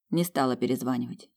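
ordinary delivery, nothing marked

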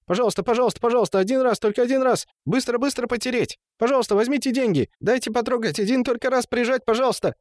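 high, frantic begging in a rush; high-pitched gabbling. A narrator's reading. Темул begs the brothel women to touch, high pleading gabble. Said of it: "высокий голос, бурно тараторивший".

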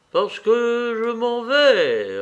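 high, loud, very drawn-out words; dying man's insistence. A narrator's reading. Dying man answering his brother's question, slow insistence. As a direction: linger on the phrase as if fond of it. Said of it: "comme s'il eût pris ce mot en affection".

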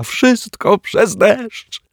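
very high, slow, through a giggle; a high, drawn-out tittering; giggling. Acted. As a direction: giggle it out in delight. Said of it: "zachichotał detektyw Pozytywka".

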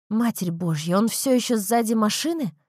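plain reading voice

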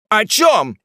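high, loud, astonished question; sudden shouted question; chorus of questioners. Acted. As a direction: burst out asking like a whole crowd. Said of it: "спросили вдруг двенадцать голосов".

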